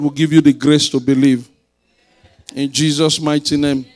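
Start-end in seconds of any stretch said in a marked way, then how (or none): none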